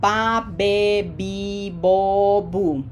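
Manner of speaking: "ba, be, bi, bo, bu" run together on a level pitch; "ba, be, bi, bo, bu" as bah, beh, bee, boh, boo; Spanish syllable demo. Shown as a demonstration. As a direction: recite "ba, be, bi, bo, bu" with pure, held vowels. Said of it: "carry the sound of the vowel".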